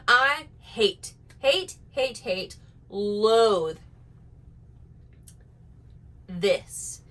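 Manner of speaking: building disgust, repeated stabs; mock-dramatic hate, hate, hate; emphatic hating. Shown as a demonstration. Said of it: "I almost did it".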